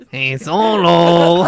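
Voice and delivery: slow, laughing put-on Mexican accent; joking Mexican accent for Lando, laughing; mock Mexican accent. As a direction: ham up a Mexican accent while cracking up. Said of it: "Why don't you do a Mexican voice for him?".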